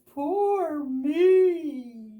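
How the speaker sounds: loud high mock wail. Lost Bear huddled by a tree, wailing. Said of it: "and he wails".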